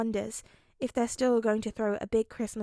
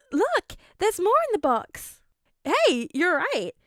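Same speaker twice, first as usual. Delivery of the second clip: excited; 8 semitones higher, 6 dB louder than usual. Acted. Characters excited.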